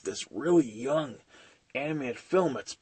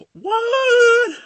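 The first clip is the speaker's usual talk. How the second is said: high, loud, drawn-out yelp; stunned disbelief; 11 dB louder than usual. Acted. Onlooker sees the villain shot, high stunned yelp. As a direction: stretch it into an incredulous squeal.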